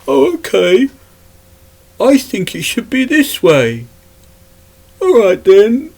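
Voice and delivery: high-pitched, silly mocking voice; dozy twit. Ranter mocking a computing designer's bright idea, dozy twit voice. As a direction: play a dim designer in a put-on high voice. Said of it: "some dozy twit".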